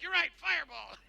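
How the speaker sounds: high, eager cheer; cheering sidekick. Acted. Ticto cheering on the fireball plan. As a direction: egg on the fight happily.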